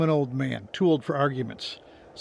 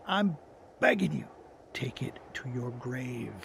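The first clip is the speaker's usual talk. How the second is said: beg, then sink to a hushed warning; grave pleading; 4 dB quieter than usual. Acted. Old man begs her for secrecy.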